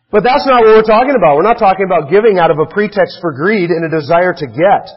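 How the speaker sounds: usual speaking voice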